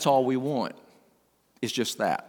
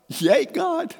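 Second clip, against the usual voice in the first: much higher joyful burst; excited cheer. Retold or imitated. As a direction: let it jump up with sudden delight. Grandpa rejoicing at granddaughter's words.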